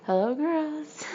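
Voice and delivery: high, creepy sing-song hello; a prankster hiding in the water, creepy and sing-song; creepy greeting. A narrator's reading. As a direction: tease them from the dark. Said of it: "in a more creepy laughing".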